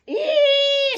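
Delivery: very high, far squeak; pig squeak. A narrator's reading. Narrator squeaking as Pig at the sky. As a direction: let out a tiny pig squeak. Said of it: "squeaked Pig".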